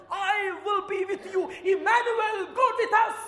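loud, high proclaiming shout; full-force sermon cry; preaching. Acted. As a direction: proclaim it to the whole church. Preaching climax.

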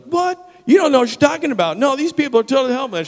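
put-on loud, high, fast indignant girl; acted high-pitched outburst; spunky teenage girl. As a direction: imitate a feisty kid jumping in to argue. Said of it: "She was the spunky one on the block".

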